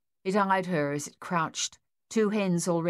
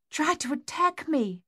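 gasped; 10 semitones higher than usual. A narrator's reading. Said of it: "their mother gasped".

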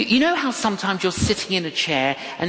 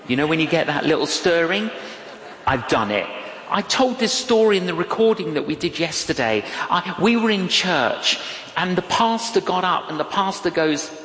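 ordinary delivery

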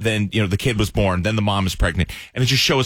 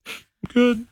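high, squeaky pretend-tearful denial; mock choked-up. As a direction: insist you're fine through mock tears. Retells hiding tears from Aaron.

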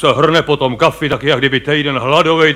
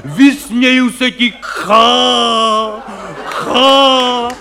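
hammy hero shout; 4 semitones higher, much slower than usual. Retold or imitated. Comedian parodies an actor roaring defiance at death. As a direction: ham it up like a bombastic stage hero. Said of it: "křičej tam, prostě řvou".

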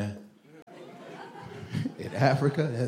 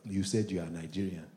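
suspicious low voice; 8 semitones lower, 12 dB quieter than usual. Retold or imitated. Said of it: "They be like".

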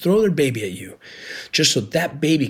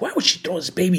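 retold anger; 6 semitones higher, faster than usual. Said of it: "he was so mad".

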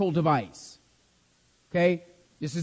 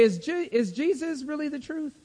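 higher, softer, unsure questioning voice; doubter's question; 6 semitones higher, 5 dB quieter than usual. Pretend. Preacher voicing a doubting believer to his class, higher and softer. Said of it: "and say".

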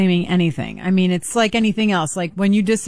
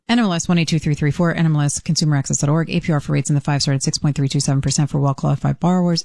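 fast, even legal disclaimer; rapid disclaimer read; 5 semitones lower, faster than usual. Real, not acted. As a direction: rattle off the fine print at top speed. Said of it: "That was a real-time read".

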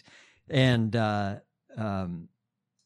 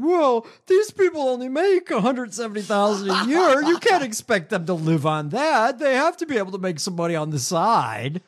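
high, whiny-pitched mocking impression, loud; Mike Johnson; 7 semitones higher, 4 dB louder than usual. Host quoting a congressman defending stock trading, mocking high voice. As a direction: put on a much higher voice to mock a politician. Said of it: "And Mike Johnson said".

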